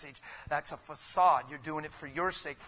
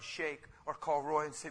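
ordinary talk

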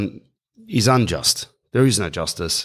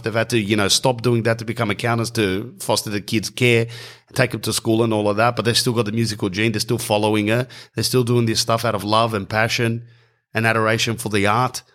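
normal talking voice

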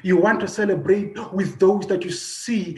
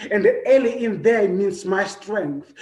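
usual speaking voice

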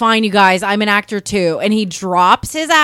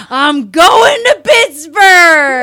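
loud, high, drawn-out holler; full-voiced imitation of a winner's shout; triumphant yell; 7 semitones higher, 8 dB louder, much slower than usual. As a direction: yell it slowly like a champion's announcement. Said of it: "And he yells".

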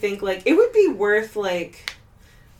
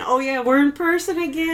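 higher-pitched, delighted realization; excited cheer; 7 semitones higher, faster than usual. Real, not acted. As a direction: celebrate it with a rising cheer. Celebrating recording together in person.

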